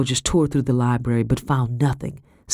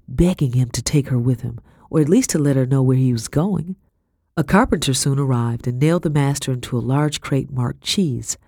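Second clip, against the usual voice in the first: ordinary delivery, nothing marked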